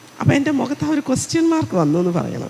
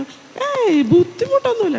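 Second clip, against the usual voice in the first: brushing it off; 12 semitones higher than usual. Retold or imitated. Patient voiced, brushing off question.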